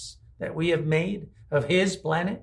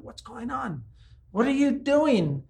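high, drawn-out bewildered plea; acted cry of bewildered questioning; questioning God; 6 semitones higher, slower than usual. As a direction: call up to God in high-pitched bafflement. Acts out people questioning God.

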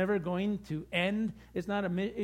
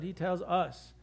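normal talking voice

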